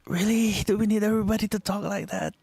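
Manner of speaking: high disbelieving complaint; mock exasperation. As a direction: roll your eyes at everyone quipping. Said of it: "and I'm like".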